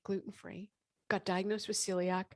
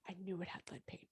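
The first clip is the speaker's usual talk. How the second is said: hushed whisper; 12 dB quieter, faster than usual. Real, not acted. Telling the host about lead paint in a whisper. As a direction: drop to a secretive whisper.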